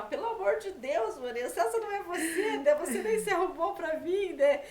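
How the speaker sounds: bronca brincalhona, mais alta e aguda, rindo; irmão brincalhão. Retold or imitated. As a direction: imitando o irmão entre risos, provocando. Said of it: "ele era brincalhão". (irmã imita o irmão zombando dela, rindo)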